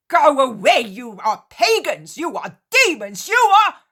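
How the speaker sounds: high, very loud furious screaming; raging curse. Acted. Cretan screaming curses at the Israelites. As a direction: spit curses at them at full volume.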